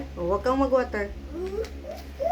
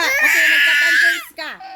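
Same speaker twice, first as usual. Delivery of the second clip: loud, higher, crying through it; crying complaint; 6 semitones higher, 7 dB louder than usual. Real, not acted. Upset child wails complaint about the conference. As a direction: wail the complaint while sobbing.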